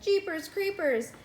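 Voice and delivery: high, startled gasp of a line; surprised exclaim. Acted. Teacher startled by the nurse's chatter, high exclaim. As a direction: jump up in pitch, startled.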